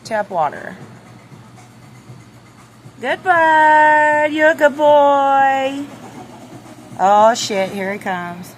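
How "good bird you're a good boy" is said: loud, high, slow praise; pet talk. Real, not acted. Owner praising the rooster loudly in a pet voice. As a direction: coo big praise at the bird.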